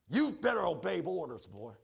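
loud, forceful brother's command; retold yelling. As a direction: imitate a brother yelling orders, moderately loud. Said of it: "he's yelling at me".

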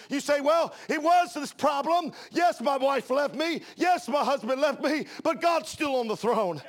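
loud, rising preacher's cadence; preaching at full force. Acted. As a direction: preach it out to the congregation.

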